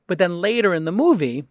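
usual speaking voice

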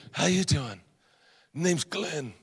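quiet, understated tough-guy imitation; Glenn. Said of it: "this is exactly how he talks".

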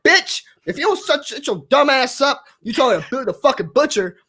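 high-pitched indignant rant; scolding a fool, worked up; indignant comeback. Acted. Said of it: "I'm like".

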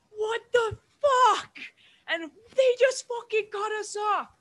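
high, drawn-out outraged yell; screaming father. Retold or imitated. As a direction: imitate dad screaming in road rage. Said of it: "I just hear my father screaming".

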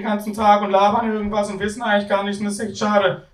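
high-pitched story character; high-voiced character. Student complaining to others in the story, high character voice. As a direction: speak up high, in a voice unlike your own.

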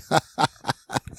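high giggling burst; mock gleeful laugh. Joking about the man sent out to the dogs, gleeful laugh. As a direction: giggle at your own gruesome impression.

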